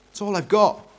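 put-on high, earnest boy voice; young Eric. Eric holds a coin out to Dad, high boy voice. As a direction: offer it up in a high voice.

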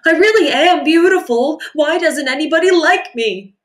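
whiny complaint. Acted. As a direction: complain to the starfish. Rainbow Fish complaining.

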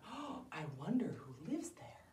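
whispered wondering. Acted. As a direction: breathe it like a nervous little boy. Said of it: "So he very quietly".

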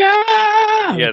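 very high, loud "Yeah" cheer; hyped shout. Retold or imitated. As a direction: yell "Yeah" high in excitement. Joining the shouted call.

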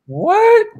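high, loud baffled what. Retold or imitated. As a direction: people hearing the line go what, high and loud in confusion. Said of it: "And people are like".

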